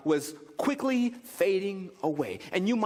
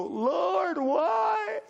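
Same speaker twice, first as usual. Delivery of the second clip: a hammed-up wail, much higher, "why" almost shouted; mock wail; 12 semitones higher than usual. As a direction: wail at God over the credit card bill, half joking. Mock wail over credit card.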